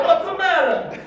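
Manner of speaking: high, loud mock Italian accent. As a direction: belt out a comic Italian accent on request. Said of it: "Do the Italian accent".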